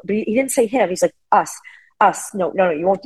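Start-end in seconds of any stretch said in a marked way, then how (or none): none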